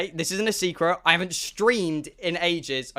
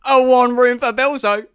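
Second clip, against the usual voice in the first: louder, grander request; mock-dignified reservation request; formal request; 5 semitones higher, 7 dB louder than usual. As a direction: present your name proudly. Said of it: "I go".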